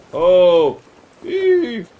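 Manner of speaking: loud, high sea-shanty heave; shanty heave call. Pretend. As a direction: haul and bellow it out. Hauling the sails.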